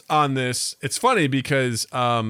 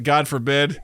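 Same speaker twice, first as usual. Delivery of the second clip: usual speaking voice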